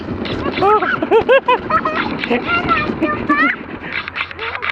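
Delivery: high delighted laughing; real laughter. Real, not acted. Laughing during the fast ride.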